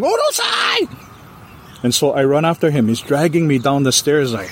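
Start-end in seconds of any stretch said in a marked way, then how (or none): 0.0-0.9 Urusai shouted sharp and very high; yelling Urusai. Retold or imitated. Husband yelling at the crying woman outside, very high shout. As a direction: bark Urusai out at the noise. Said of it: "he's yelling".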